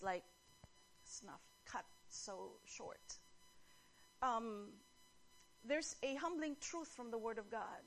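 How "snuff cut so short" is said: hushed. Real, not acted. Sorrowful ending of a life.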